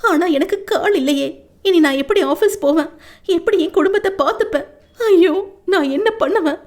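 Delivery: high, rising lament ending in a sob; wailing despair. A narrator's reading. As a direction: cry out helplessly, voice cracking. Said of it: "அவள் கதறலை கேட்டு".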